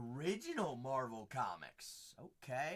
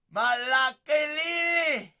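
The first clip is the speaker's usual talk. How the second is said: excited exclaim; 11 semitones higher, 11 dB louder than usual. Acted. Exclaiming over a card.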